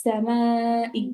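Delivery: slow Quranic stretch on আস-সামাই; stretched madd vowel. Shown as a demonstration. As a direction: pull the vowel of আস-সামাই out long, as in recitation. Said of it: "মোটা চিহ্ন থাকলে আমরা হচ্ছে টেনে পড়বো".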